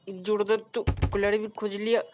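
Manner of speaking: normal talking voice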